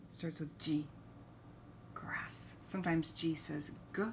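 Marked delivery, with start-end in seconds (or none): 1.9-2.5 whispered word; whispering. Acted. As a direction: breathe the word softly.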